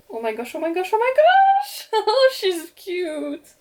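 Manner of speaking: real delighted squeal over a new doll; excited shriek. Real, not acted. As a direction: let out a thrilled fangirl squeal. Unboxing her favourite doll.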